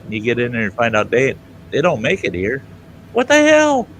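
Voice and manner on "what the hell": shocked high what the hell; incredulous. Real, not acted. Comedian baffled at tiny McDonald's, high disbelief. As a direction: throw up your hands in disbelief.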